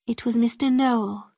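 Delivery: ordinary delivery, nothing marked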